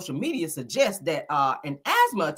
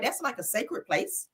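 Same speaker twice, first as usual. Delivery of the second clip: usual speaking voice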